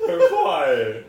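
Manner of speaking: high, loud, very slow laughing scold; amused reproach. Real, not acted. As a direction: drag it out through laughter.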